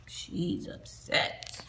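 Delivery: slow breathy aside to the listener; hushed aside. A narrator's reading. Reader whispering an aside to the child, hushed. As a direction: lean in and hush it.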